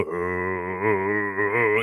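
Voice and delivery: low, sustained vocal drone; imitated soundtrack drone. Host imitates God of War soundtrack, low droning hum. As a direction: hum a deep, held soundtrack note.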